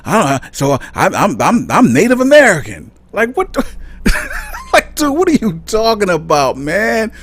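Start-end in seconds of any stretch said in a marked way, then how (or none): 1.7-2.9 high, louder mock of the excited claim; mimicking Walker. Vlogger mocking Herschel Walker's claim, high and louder. 3.1-7.1 high, laughing disbelief; incredulous laugh. Real, not acted. Vlogger laughing in disbelief at Walker's claim.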